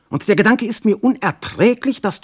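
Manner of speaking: high, loud cry of pain; anguished outburst. Acted. Jealous god to Alkmene, crying out loud and high. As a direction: let the jealousy burst out high.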